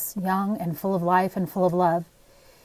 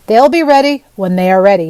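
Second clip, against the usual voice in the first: gruff; 10 dB louder than usual. Acted. Grumpy woodcarver snaps at widow.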